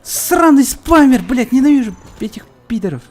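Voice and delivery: angry yell. Real, not acted. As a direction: draw out the rage at the spammer. Gamer raging at spamming opponent.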